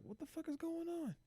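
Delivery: tiny high falsetto, soft and baffled; mock bewilderment. Everybody watching the mom's rant, high soft bewilderment. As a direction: squeak out the crowd's confusion under your breath.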